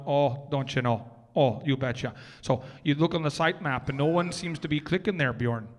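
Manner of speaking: hammed-up Minnesota vowels; Minnesotan accent. Presenter as a Minnesotan looking at the site map, Minnesota accent. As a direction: chat in a broad put-on Minnesotan accent. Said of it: "if you would like a Minnesotan".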